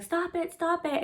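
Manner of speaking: put-on high, small child's plea, a touch soft; a small girl begging them to stop; little girl pleading. Five-year-old daughter begs fighting parents to stop, high and small.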